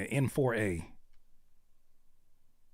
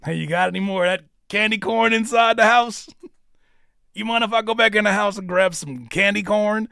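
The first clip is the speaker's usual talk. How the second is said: mock eager fan; 6 semitones higher, 8 dB louder than usual. Mocking eager candy corn fan.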